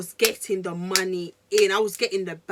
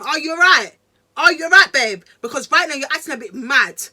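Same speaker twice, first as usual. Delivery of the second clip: put-on loud, high, anxious calling to boyfriend; loud worried call; 5 semitones higher, 10 dB louder than usual. Girlfriend anxiously calling out to panicking boyfriend, loud. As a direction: call out to him, alarmed. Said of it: "I was like to him".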